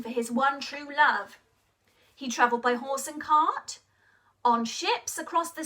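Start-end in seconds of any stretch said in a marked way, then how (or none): none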